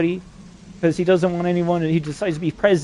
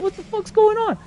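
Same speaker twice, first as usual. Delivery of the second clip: high panicked disbelief. Retold or imitated. Freaking out at the voices, high mock panic.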